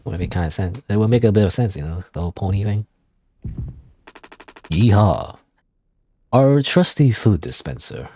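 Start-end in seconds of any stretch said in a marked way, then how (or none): 4.7-8.1 lightly drawled cowboy yeehaw. Acted. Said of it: "does Curly sound like a cowboy instead".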